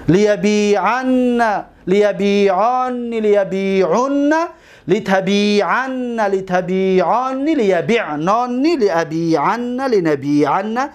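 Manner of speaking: each form drawn out slowly; slow conjugation drill. Shown as a demonstration. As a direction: recite the forms slowly for the class. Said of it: "هشت صیغهٔ امر". (صرف آهسته و شمرده)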